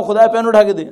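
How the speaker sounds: mock demand to God. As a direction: bark an order at God like a spoiled child. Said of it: "خدا سے کہو".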